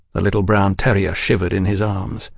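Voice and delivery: ordinary delivery, nothing marked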